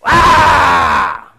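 long, high, loud yell; full-throated acted scream; battle roar. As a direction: let out a drawn-out war cry. Acted giant's battle cry.

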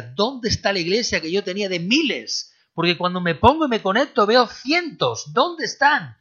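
mock a crying pastor missing his crowds; mock lament. Preacher mocks a weeping pastor asking for his lost thousands. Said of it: "Un pastor lloraba y decía".

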